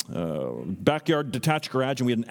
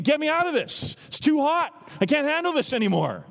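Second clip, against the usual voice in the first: desperate plea; 12 semitones higher than usual. Retold or imitated. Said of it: "We're thinking".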